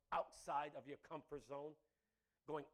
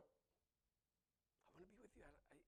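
much softer, slow and intimate; hushed prayer; 4 semitones higher, 23 dB quieter, slower than usual. Retold or imitated. Preacher whispering his own prayer to the Lord, soft and slow. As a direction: breathe it to the Lord, barely voiced. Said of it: "I've said that to the Lord".

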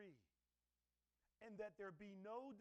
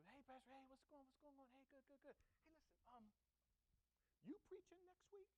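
higher, softer, casual probing voice; nosy church member; 7 semitones higher, 6 dB quieter than usual. Retold or imitated. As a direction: fish for information while sounding friendly.

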